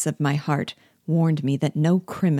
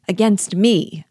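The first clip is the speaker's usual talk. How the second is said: higher, louder cry of protest; indignant exclamation; 5 semitones higher, 6 dB louder, slower than usual. A narrator's reading. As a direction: exclaim it, taken aback. Startled challenge in read dialogue.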